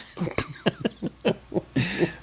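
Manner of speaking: soft, drawn-out real laughter; amused laugh. Real, not acted. Co-host amused at the pig pen remark, soft laughter. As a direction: laugh softly for a good stretch.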